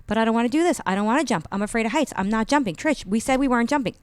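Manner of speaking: high, loud retold whining protest. Speaker begging her friend not to make her jump, protesting. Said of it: "And I'm protesting".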